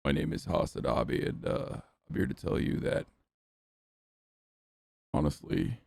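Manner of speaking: low, breathy gravelly fry; creaky husky YouTuber intro; male vocal fry. Retold or imitated. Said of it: "called Male Vocal Fry".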